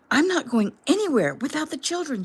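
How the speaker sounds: a frantic defiant scream. A narrator's reading. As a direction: scream your refusal at him. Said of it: "she screamed".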